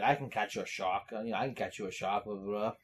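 low, soft muttered quote; lightly voiced Quint. Host quoting Quint at the town meeting, low and soft. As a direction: mumble it down low. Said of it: "where he's like".

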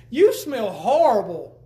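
slow, loud disgusted wife; mock the wife wrinkling her nose; a wife scolding her smoky husband, slow and loud; imitating the wife.

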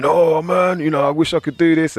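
a hint of starry-eyed youngster; wistful young fan. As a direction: wish it out like a teenager hearing hip hop. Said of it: "and thinking".

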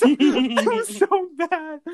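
high, slow, laughing through it; real laughter. Real, not acted. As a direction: giggle out the words. Laughing at own story.

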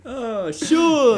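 high, drawn-out exclamation; catchphrase impression. Friend repeats the bit for the podcast intro, mimicking.

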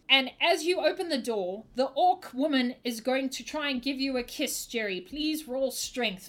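normal talking voice

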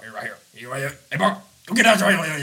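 imitating a hard-to-understand granddad; garbled old-timer, jumping up at the end; Chicken impression. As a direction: run the words together like the Waterboy assistant coach. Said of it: "he talks like".

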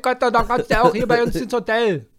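put-on loud, high East German accent; hammed-up East German character, pitched high; Ossi accent. Mock hotelier ordering the chain off, loud Ossi accent. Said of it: "Ich könnte dir stundenlang zuhören mit Ossi".

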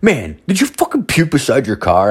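high-pitched accusing question; mock confrontation. Stranger confronting him about the puke, mock outrage. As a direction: imitate an appalled stranger.

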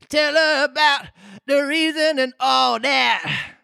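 very high, slow, calling out; drawn-out squeaky demand; Mock Turtle. A narrator's reading. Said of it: "he said to the Griffin".

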